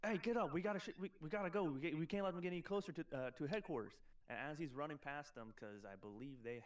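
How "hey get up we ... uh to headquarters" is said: raised, higher-pitched urgent orders; acted shout of a guard rallying his men. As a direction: call out to rouse the troops, easing off at the end. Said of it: "he's gonna be shouting orders at them".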